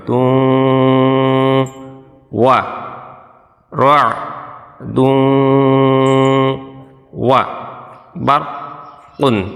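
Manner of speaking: each syllable said on its own; teacher drilling students in broken reading. Shown as a demonstration. Syllables spaced apart. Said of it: "ভাঙ্গা ভাঙ্গা উচ্চারণ করবেন".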